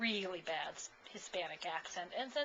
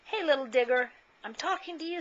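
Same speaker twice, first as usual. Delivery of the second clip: higher, louder mother's call; mama calling her boy; 5 semitones higher, 5 dB louder than usual. Acted. Mother calls her son.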